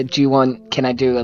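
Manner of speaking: ordinary talk, nothing marked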